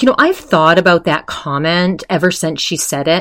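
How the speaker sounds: usual speaking voice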